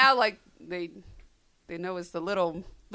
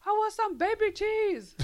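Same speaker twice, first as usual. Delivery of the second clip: imitating a small boy, higher and slow; kid voice; 12 semitones higher, 5 dB louder, slower than usual. Mother mimics her little son asking for baby cheese. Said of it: "he's like".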